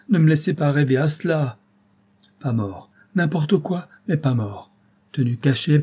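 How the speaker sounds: put-on old man refusing to believe he is dead, crying out; crying out, beside himself. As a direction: beg as if out of your mind. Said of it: "Il prononça ces paroles en criant".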